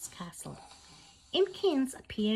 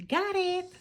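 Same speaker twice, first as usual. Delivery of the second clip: high, louder win; triumphant cry; 9 semitones higher, 4 dB louder than usual. Acted. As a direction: grab the key in triumph, much higher. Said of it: "she cried".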